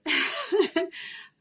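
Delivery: high embarrassed laugh through tears; teary laugh. Real, not acted.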